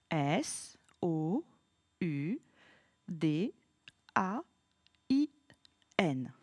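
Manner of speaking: letters said slowly and low, one at a time; slow spelling aloud. Shown as a demonstration. Teacher spells the word along with the pupil, slowly. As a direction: spell Soudain letter by letter with the child. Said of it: "on le fait ensemble".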